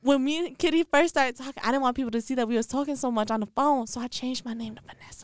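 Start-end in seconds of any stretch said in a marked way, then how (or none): 3.8-5.2 soft, hushed admission; confessing in a whisper; whispered confession. Real, not acted. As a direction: whisper it sheepishly. Sheepish confession aside.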